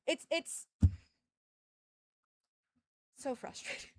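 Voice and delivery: breathy whispered groan; whispered exasperation; whispered frustration. Real, not acted. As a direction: sigh it out defeated. Venting frustration.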